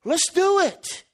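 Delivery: eager proposal. Retold or imitated. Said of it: "he goes".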